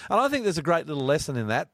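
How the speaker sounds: ordinary talk, nothing marked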